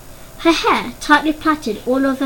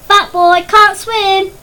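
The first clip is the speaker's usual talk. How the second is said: loud, higher jeering voice; taunting; 8 semitones higher, 8 dB louder, much slower than usual. A narrator's reading. Boy mocks Danny from the pool, loud jeering taunt. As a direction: jeer at him like a playground bully.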